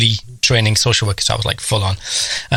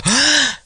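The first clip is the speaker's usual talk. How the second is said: sharp higher mock gasp; shocked gasp; 14 semitones higher than usual. Family hearing a four-year-old swear, mock gasp. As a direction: gasp like the whole family did.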